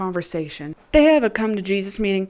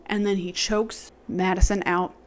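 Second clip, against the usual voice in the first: ordinary talk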